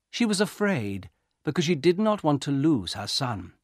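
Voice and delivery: ordinary delivery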